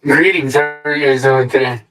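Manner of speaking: tinny mic, echoing small room. Real, not acted. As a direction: voice through a cheap echoey mic. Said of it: "a tinny echo".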